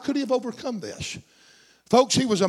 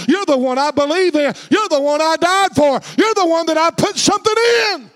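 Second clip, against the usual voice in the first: God's impassioned affirmation; 2 semitones higher, 5 dB louder than usual. Acted. God assuring his chosen one, building to a shouted declaration. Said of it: "God said".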